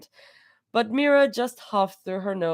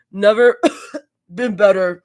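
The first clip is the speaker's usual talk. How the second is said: wheezing; 8 dB louder than usual. A narrator's reading. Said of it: "wheezing between coughs".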